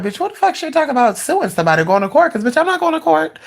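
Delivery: high-pitched, rapid incredulous inner rant; mock indignation. Storyteller replays her thoughts on the reading, indignant. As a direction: race through it, high and affronted.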